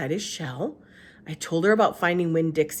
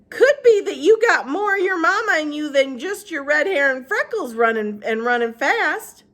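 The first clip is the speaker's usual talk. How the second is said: Gloria Dump voice; 10 semitones higher, 8 dB louder than usual. Acted. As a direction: speak up high and unhurried.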